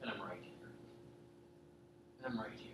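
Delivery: very soft, low, gentle; God whispering in the heart; soft inner voice. Retold or imitated. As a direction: speak it as a still small voice.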